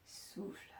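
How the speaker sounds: very soft, voiceless exhale on the word; breathed-out cue. Shown as a demonstration. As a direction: breathe the word out slowly and softly. (soufflé tout bas, en expirant)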